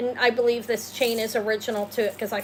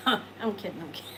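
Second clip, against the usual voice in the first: giggling through a tease; laughing it off; 2 semitones lower, 4 dB quieter than usual. Real, not acted. Laughing as she takes back the teasing viewer question. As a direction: laugh while taking it back.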